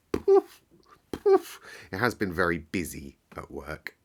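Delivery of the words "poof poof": high, loud "Poof" puffs of heat; hot puff. Real, not acted. Worn out from heat at work, puffing high "Poof". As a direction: blow out like you're overheated. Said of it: "I've been sweltering".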